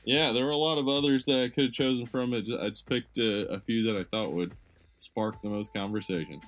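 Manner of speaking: normal talking voice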